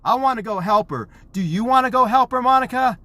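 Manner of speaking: high, loud play-acted alarm; rally a child to a rescue, high and loud; acted excited urging. Dad acts out rallying his daughter.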